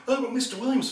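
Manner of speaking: high-pitched overwhelmed fan; flustered, freaking out. Retold or imitated. Starstruck actor trying to interrupt his idol, freaking out. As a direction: try to cut in, voice jumping high. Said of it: "I'm freaking out, going".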